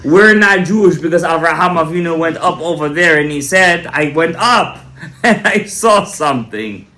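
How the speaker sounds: loud preacher's delivery, key words stretched out; emphatic preaching. Real, not acted. Rabbi answering a doubter before listeners, emphatic preaching. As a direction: drive each point home to the congregation.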